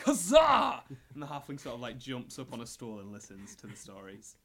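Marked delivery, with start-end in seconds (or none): 0.0-0.8 big high shout of "Huzzah!"; loud, high, hammed-up battle cheer; triumphant knight. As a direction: cry it out like a boastful knight mid-tale. Voicing the knight telling stories.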